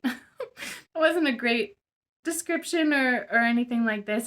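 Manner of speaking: real embarrassed laughter breaking into speech; self-deprecating laugh. Real, not acted. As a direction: laugh off your own clumsy explanation. Laughs at own clumsy explanation.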